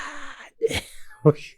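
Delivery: crying laugh. Retold or imitated. Recalling the stinging shower.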